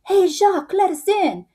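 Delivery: high-pitched mock French soldier calling out; pretending to be French. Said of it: "pretending like they're French".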